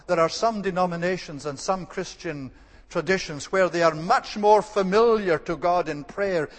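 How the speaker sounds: normal talking voice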